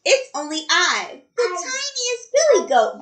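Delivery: tiny, high-pitched little goat; very small voice. A narrator's reading. Smallest goat answers the troll, in a tiny high voice. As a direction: squeak it like the tiniest goat. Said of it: "saying it in his very, very small voice".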